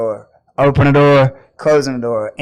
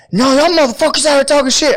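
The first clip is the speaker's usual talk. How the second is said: angry yelling; 9 semitones higher, much faster than usual. Retold or imitated. Same angry guy yelling again.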